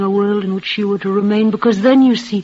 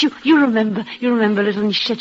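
ordinary talk, nothing marked

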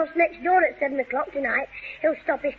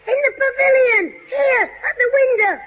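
calling out; 5 semitones higher, 4 dB louder than usual. Acted. Calling down from window.

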